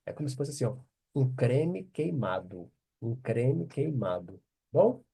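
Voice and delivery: normal talking voice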